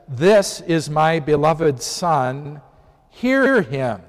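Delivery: very slow, drawn-out divine proclamation; voice from heaven. Retold or imitated. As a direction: declare it slowly as God from the cloud. Voice from the cloud, repeated.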